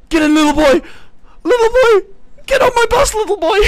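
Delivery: high, loud, creepy coaxing voice; hammed-up creepy stranger voice; creepy bus driver. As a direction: lure the little boy on in a shrill put-on voice. Luring passenger onto bus.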